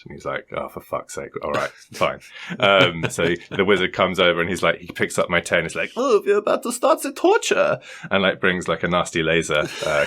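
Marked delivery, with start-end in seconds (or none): 0.6-2.2 quiet, resigned mock sigh; grudging resignation. Game master giving in to the player's scheme, grudgingly. 6.0-7.8 high-pitched hammed-up German-accented villain; German-sounding accent. Wizard villain to his chained-up captive, mock German accent. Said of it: "is that the intended accent".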